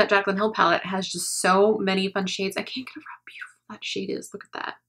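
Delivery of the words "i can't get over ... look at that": awed whisper; hushed, admiring the shade. Real, not acted.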